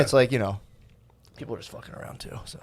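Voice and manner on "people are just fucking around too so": whisper. Real, not acted. Trails off nearly silent.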